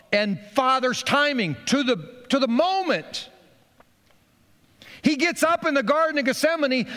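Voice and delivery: high, ringing preacher's call; fervent preaching. Acted. Pastor building to Gethsemane before communion, fervent preaching. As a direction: lift the room, rising with each phrase.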